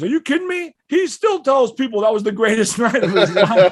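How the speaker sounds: very high, loud exclamation; hammed-up high-pitched disbelief; acted incredulous excitement. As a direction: exclaim it as the amazed guy, breaking into a laugh. Excited man's emphatic answer, acted.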